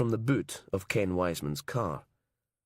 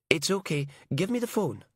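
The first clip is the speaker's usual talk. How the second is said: gentle nurse; 8 semitones higher than usual. Acted. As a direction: coax him to stop, kindly and high. Nurse takes the phone.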